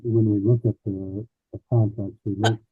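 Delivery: muffled, as if through a wall. Real, not acted. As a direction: voice blocked by paperwork over the mic. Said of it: "You sound muffled".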